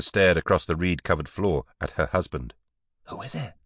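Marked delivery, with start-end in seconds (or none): 3.1-3.5 high, hushed whisper. A narrator's reading. Wife to husband after a knock at night, whispered. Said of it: "she whispered".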